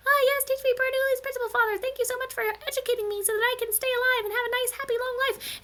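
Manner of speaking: very high, squeaky little pup voice; cartoon baby animal in falsetto; baby prairie dog. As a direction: gush thanks like a tiny eager child. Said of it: "the baby prairie dog says".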